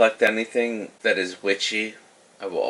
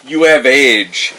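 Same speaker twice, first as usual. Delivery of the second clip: much louder, slow and weighty; emphatic; 3 semitones higher, 13 dB louder, slower than usual. Acted. Tannic answers Rafim, loud and slow. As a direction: land it hard, slowly. Player answers the elf loudly.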